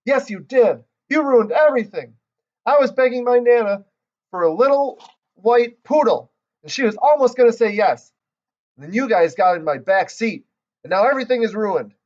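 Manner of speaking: put-on spoiled girl whining angrily; upset complaining. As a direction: stamp your foot and blame them. Lucille stamps her foot, upset.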